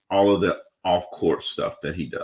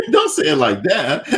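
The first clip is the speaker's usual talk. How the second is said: high, protesting through laughter; laughing protest; 8 semitones higher than usual. Real, not acted. Host teases guest over her cool reaction, laughing protest. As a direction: object while cracking up.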